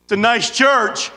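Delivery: loud, booming, no inside voice. Shown as a demonstration. Man without an inside voice greeting people, booming. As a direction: bellow it like the loudest man there. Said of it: "most men talk like this".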